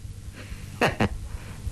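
a breathy, voiceless sneer of a laugh; contemptuous unvoiced chuckle; cold sneering laugh. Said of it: "欧阳锋冷笑一声".

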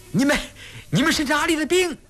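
fast, high-pitched demanding yell; shouted challenge. A narrator's reading. Someone in the uproar challenges unknown soldiers, shouting. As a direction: stammer once, then fire off the demand. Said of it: "有的人在喝问".

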